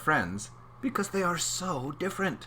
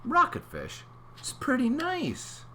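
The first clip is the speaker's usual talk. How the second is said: usual speaking voice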